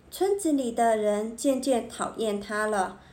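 usual speaking voice

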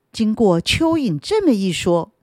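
plain reading voice

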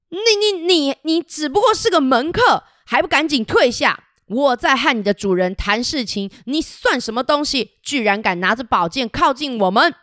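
high, stuttering scolding from an outraged king; king sputtering at an upstart retainer; stammering royal rage. Acted. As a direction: spit out the insult like an offended king. King scolds Mao Sui.

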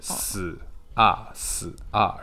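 "死啊" as separate s and ah sounds. Shown as a demonstration. Teacher helps student link sounds, slowly separated "死啊". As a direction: sound out "死啊" one piece at a time. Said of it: "你要一个一个慢慢来".

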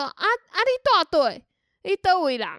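lightly voiced old uncle prying; a hint of a nosy grandpa asking questions; imitating nosy old uncle.

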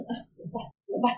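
whispered, fretting to himself; anxious whisper. Retold or imitated. Nervous teller whispers to himself on the bus seat. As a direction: breathe it out in worried panic.

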